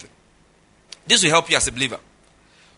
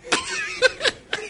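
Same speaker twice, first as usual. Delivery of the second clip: high, giggly laugh; real laughter; 4 dB quieter than usual. Real, not acted. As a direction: giggle at your own punchline. Laughing at his own joke.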